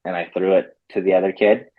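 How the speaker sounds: normal talking voice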